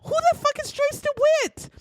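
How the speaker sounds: high, disbelieving cop demanding an answer; mock incredulous cop. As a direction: squeak it out like the cops can't believe it. Police baffled by second woman.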